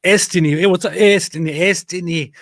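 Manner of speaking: loud, high call to a viewer; calling out a greeting. Real, not acted. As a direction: hail the viewer's name with delight.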